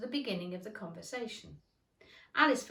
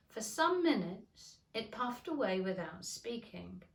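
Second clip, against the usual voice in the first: usual speaking voice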